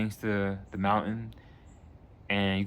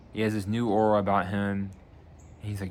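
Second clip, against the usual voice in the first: ordinary talk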